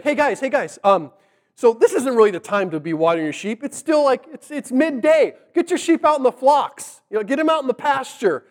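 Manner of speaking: high, hurried urging; excited. Retold or imitated. Jacob shooing shepherds away from the well, excited. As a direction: talk fast and high, eager to send them off. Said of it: "Jacob gets excited".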